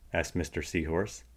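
plain reading voice

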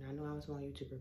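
normal talking voice

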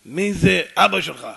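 slow, very loud questioning; acted immovable interrogator; dogged demand. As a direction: boom the question out word by word. Stranger doggedly repeats the question.

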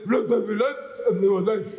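"Let there be light" mumbled with lazy, gummy consonants, half laughing; mock toothless speech. Preacher mocking a toothless God for the crowd, slurred and gummy. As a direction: mumble it as if you had no teeth. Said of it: "If He's got a mouth and no teeth".

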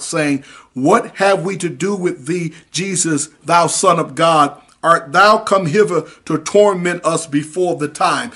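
slow measured King James reading; scripture reading. Shown as a demonstration. Preacher reading the demons' words from scripture, slow and solemn. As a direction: read the verse out slowly and solemnly. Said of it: "Verse twenty-nine".

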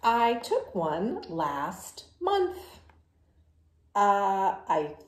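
loud, brash pigeon voice, brushing it off; the pigeon, loud and sure of itself, to the kids; cocky pigeon. Acted. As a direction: play the stubborn pigeon who dodges the bath. Pigeon character in picture book.

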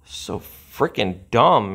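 ordinary talk

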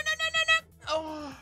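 ordinary talk

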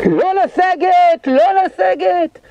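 high, slow woman's call over the radio; radio operator yelling. Retold or imitated. Radio operator yells at retreating troops, high and slow. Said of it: "שומעים אלחוטי צועקת".

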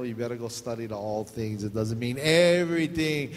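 ordinary talk

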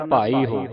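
ਭਾਈ ਹੋ run together as one word; read joined together. Shown as a demonstration. Teacher tells pupil to read ਭਾਈ ਹੋ together, joined model. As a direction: link ਭਾਈ ਹੋ without a break. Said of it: "ਇਕੱਠਾ ਪੜ੍ਹਨਾ".

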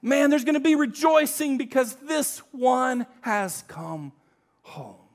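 loud, high celebrating call, fading on home; joyful shout. Pretend. Joyful father calls for a party, loud then fading. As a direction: announce a homecoming feast. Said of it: "And he says".

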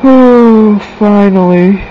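high, loud sigh of triumph; giddy relief. Real, not acted. Gamer finally relieved after long attempts, loud giddy cry. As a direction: let it burst out. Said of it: "I'm giddy".

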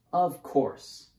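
brightly emphatic, courteous assent; polite reply. A narrator's reading. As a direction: answer like an obliging hostess. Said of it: "Olivia replied politely".

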